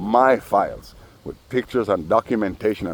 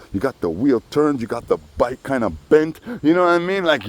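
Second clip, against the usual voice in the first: ordinary delivery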